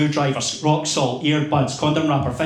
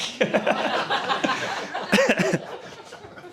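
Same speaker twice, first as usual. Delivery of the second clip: laughing; 10 semitones higher, 4 dB quieter than usual. Real, not acted. Laughs at own poem title.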